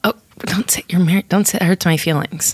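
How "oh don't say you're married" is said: whispering. Acted. Hurt wife pleading.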